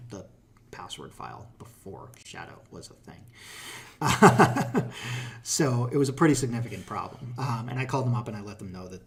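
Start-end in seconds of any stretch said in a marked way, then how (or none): 3.3-5.4 loud laugh. Real, not acted. Laugh at old security hole.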